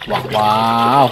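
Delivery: ordinary delivery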